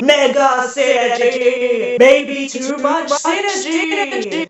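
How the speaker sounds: slow, loud sung proclamation; hammed-up epic shout; dramatic announcement. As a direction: belt it like a movie trailer.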